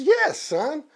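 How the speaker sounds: very high mock mom voice; mother bear. Mother bear sending cub to father, high motherly voice. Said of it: "She goes".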